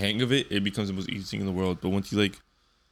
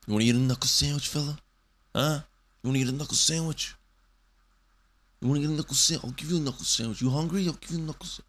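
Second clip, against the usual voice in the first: mock tough guy, quick and higher; tough guy threat; 6 semitones higher, faster than usual. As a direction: jab it like a corny bully. Acting out tough-guy insult.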